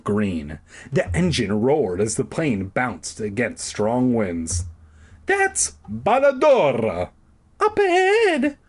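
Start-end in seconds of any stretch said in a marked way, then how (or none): 5.3-8.6 put-on very high, slow girly call; high announcing voice. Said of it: "Carolyn announced in her new voice".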